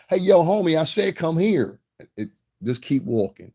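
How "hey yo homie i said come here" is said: loud, high holler; louder insistent call. Retold or imitated. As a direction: imitate a caller getting louder and more demanding. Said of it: "it kept getting louder".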